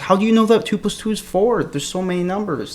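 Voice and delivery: high-pitched sceptic questioning basic maths; mock incredulity. Debater parodying the too-many-religions argument, mock incredulous.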